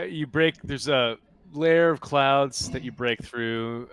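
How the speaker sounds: ordinary delivery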